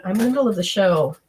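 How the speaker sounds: ordinary talk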